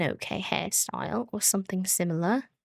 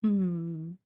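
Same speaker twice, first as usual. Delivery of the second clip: normal talking voice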